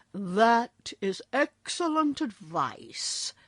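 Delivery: slow, approving bear voice. A narrator's reading. Lavender Bear approving the wizard, slow. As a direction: praise the wizard's advice. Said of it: "said the Lavender Bear approvingly".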